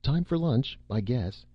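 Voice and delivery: bright, high cheerful call; breezy announcement of lunch; cheerful, a touch forced. A narrator's reading. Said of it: "said cheerfully".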